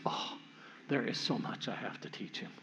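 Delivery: a mock-weary sister, chuckling; lightly played exasperated big sister, laughing; exasperated sigh. Sister sighs to father, hands on hips.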